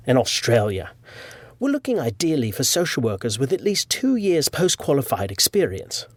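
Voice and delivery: put-on British accent of a recruiter; playful mock-British email voice. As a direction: read it out in your best amateur British accent. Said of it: "do my best British accent".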